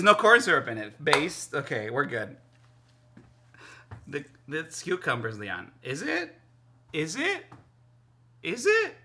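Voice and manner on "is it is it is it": teasing rising is it. Real, not acted. Teasing disbelief.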